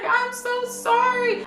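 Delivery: high, slow wailing sorry; mock crying apology. Girl crying sorry to the narrator, high slow wail. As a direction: whine it through fake tears. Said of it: "She was like".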